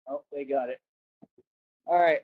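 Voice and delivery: normal talking voice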